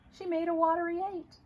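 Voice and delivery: ordinary delivery, nothing marked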